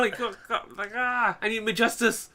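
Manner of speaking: high, pleading mock cry for justice; mock character plea. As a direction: ham up the character's desperate plea. Voicing an anime character's plea.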